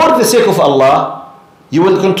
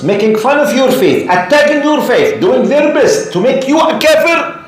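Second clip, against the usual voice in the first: building to a shout; 9 semitones higher than usual. Real, not acted. Preacher lists the parents' wrongs to the crowd, building to a shout. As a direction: build each phrase up until you shout.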